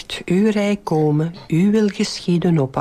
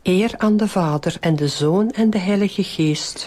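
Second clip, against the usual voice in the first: even, measured rosary lead; devout, rote Hail Mary cadence; prayer recitation. Real, not acted. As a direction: recite a well-known prayer in steady phrases. Leader recites the doxology.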